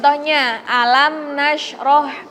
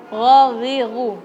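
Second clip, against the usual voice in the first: normal talking voice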